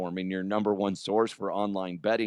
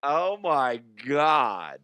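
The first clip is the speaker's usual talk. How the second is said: drawn-out, higher exclamation of disbelief; mock shock; 4 semitones higher, 4 dB louder, much slower than usual. Real, not acted. Host reacting to his own sum, mock shock. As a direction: stretch it slowly, pitched up, aghast.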